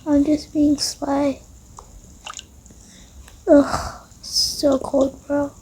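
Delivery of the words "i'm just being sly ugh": usual speaking voice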